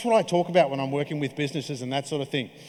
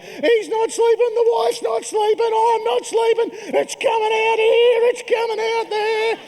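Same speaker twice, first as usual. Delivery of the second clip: much higher, loud, cracked whine; whinging new dad; 5 dB louder, slower than usual. Retold or imitated. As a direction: complain like an exhausted new dad falling apart. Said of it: "flicked this whinge switch".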